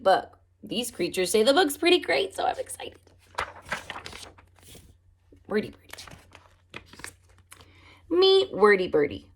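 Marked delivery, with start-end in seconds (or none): none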